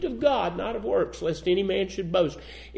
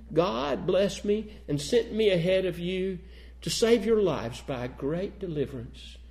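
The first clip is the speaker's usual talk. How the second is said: softer, gentle reassurance; tender forgiveness; 2 semitones lower, 7 dB quieter than usual. Retold or imitated. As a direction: comfort the brothers quietly. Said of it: "He says".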